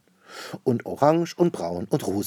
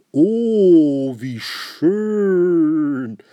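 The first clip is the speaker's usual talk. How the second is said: huge, high, stretched-out swoon; breathless rapture; 9 semitones higher, 10 dB louder, much slower than usual. A narrator's reading. Man admires the painted house, breathless rapture. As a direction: let each word soar loud and long in awe. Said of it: "sagt er atemlos".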